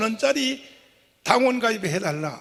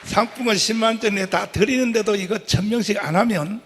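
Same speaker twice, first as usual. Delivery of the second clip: ordinary talk, nothing marked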